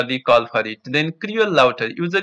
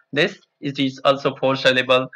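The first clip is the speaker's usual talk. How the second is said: ordinary talk, nothing marked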